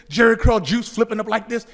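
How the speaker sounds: normal talking voice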